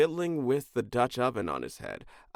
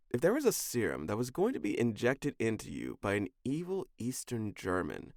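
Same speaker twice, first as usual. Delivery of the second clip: ordinary delivery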